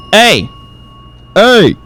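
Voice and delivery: loud, high hey; hammed-up wake-up call. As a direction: shout to wake a sleeper. Calling to wake him up.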